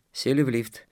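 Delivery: plain reading voice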